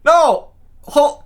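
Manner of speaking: high mock disbelief; a high, knowing no; hammed-up doubting neighbor; disbelieving neighbor. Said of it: "He goes".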